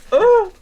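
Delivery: high, loud nose-blowing noise; sudden high honk; mimed nose-blow. Acted. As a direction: honk into a handkerchief. Mimics nose blown into handkerchief.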